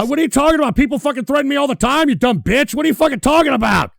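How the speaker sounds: angry retort. Real, not acted. Snaps back at clip woman.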